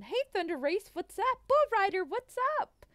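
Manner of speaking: high, loud greetings rising at the end; excited shout-out. Real, not acted. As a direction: hail each arriving viewer with excitement. Calling out greetings to viewers.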